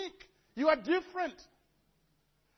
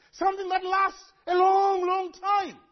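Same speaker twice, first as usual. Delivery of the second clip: mock exaggerated plea; 6 semitones higher, 7 dB louder than usual. Preacher acting out begging God for lasting, hammed-up shout. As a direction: ham up the longing for permanence.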